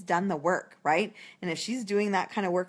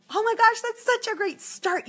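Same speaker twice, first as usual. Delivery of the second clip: over-the-top praise; 4 dB louder than usual. Retold or imitated. Consultant cheering hostess who has thirteen guests, squealing with delight. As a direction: cheer her thirteen guests like it's amazing. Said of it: "She might say thirteen".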